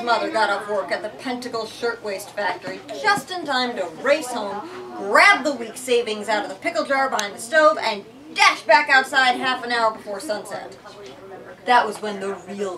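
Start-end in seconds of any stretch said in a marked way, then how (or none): none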